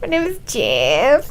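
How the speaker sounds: slow, awkward fake Spanish accent; stilted comic Spanish accent, ending on a rise; mock Spanish accent. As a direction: draw it out in a bad Spanish accent. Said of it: "trying to speak with his Spanish accent".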